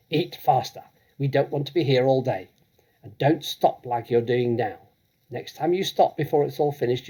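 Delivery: bullying headmistress. Acted. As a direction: threaten him with the chokey. Trunchbull threatens boy.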